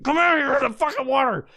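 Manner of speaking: high, quick retold call; calling the dog. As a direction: imitate calling a dog over in a hurry. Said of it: "I'm, like, calling her".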